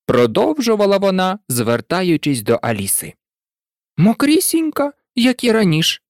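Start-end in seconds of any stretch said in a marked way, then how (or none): 4.0-6.0 put-on high, mildly mournful girl character; sad Alisa. A narrator's reading. Alisa answering the mouse, still wet, mildly sad. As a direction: answer a little sadly in a high voice. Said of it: "сумно відповіла Аліса".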